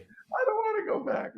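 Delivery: very high, whining plea; whiny complaint. Retold or imitated. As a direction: whine like a kid who won't go to school.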